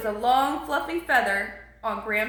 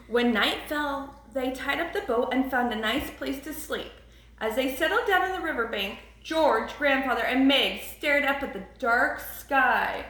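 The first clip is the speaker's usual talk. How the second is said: ordinary delivery, nothing marked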